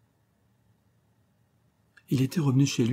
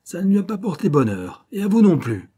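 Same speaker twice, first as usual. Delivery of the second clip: much higher, louder menace; gloating threat; 10 semitones higher, 7 dB louder than usual. Acted. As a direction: gloat over him. Schiller gloats.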